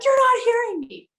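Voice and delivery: high, loud accusing cry. Retold or imitated. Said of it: "instead of being like".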